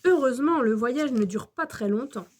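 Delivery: usual speaking voice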